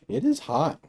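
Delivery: surprised exclaim. Real, not acted. Reacting to hot drink.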